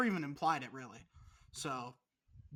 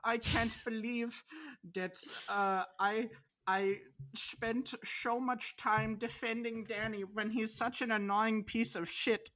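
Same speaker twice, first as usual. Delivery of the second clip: mock German accent; 3 semitones higher, slower than usual. Imitating German Robert complaining about Danny. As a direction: drag out a hammed-up German voice. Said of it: "he's gonna be like".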